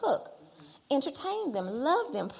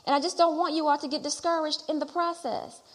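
usual speaking voice

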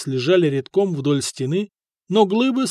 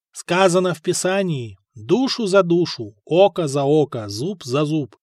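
ordinary delivery, nothing marked

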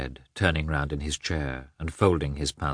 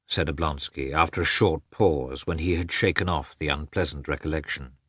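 plain reading voice

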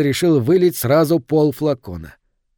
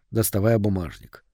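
ordinary delivery, nothing marked

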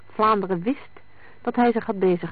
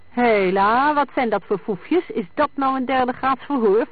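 higher, louder indignant challenge; threatening; 6 semitones higher, 4 dB louder than usual. A narrator's reading. Sammy objecting to Vlaanderen's interrogation, threatening. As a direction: push back menacingly at the questioner. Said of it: "vroeg Sammy dreigend".

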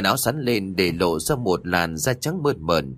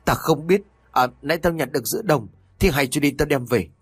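high, rushed defensive snap; a guilty old man snapping back; 6 semitones higher than usual. Acted. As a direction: bluster and brush the question off fast. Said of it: "Lão Linh liền gắt".

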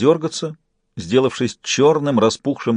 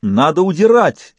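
high-pitched urgent cry; alarmed exclamation; 6 semitones higher, 4 dB louder than usual. A narrator's reading. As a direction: exclaim it, ready to bolt. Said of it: "воскликнули близнецы".

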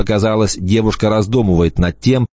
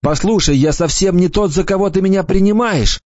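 croaked; 11 semitones higher than usual. A narrator's reading. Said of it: "прохрипел я".

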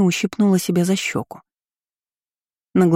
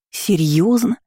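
plain reading voice

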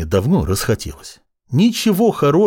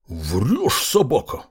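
whistling s; much slower than usual. A narrator's reading. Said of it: "с присвистом воскликнул Фёдор Прокопич".

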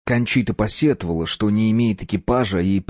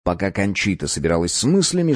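ordinary delivery

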